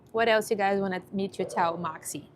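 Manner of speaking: usual speaking voice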